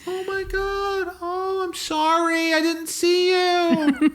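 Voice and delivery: very high, drawn-out cooing wail; hammed-up fussing over a dog, slow and high; oblivious dog lady. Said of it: "And the lady goes".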